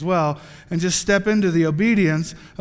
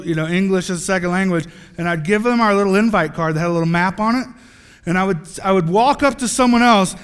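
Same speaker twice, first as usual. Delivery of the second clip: ordinary talk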